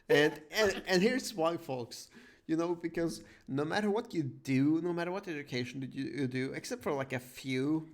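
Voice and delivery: ordinary talk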